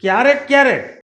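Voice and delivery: high, loud disbelieving repeat of ક્યારેક ક્યારેક; father scolding his son, shocked echo; incredulous echo. Real, not acted. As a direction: throw the words back in disbelief. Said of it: "ક્યારેક ક્યારેક વાંચે નો હાલે".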